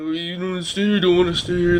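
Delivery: mock slurred mumble; indistinct, words smeared together; imitated mumbling. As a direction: mumble it like a creepy weirdo. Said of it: "like, mumbling".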